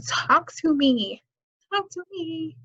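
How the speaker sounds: mock-beg them, stretching the words; pleading. Acting out a plea for communication, pleading.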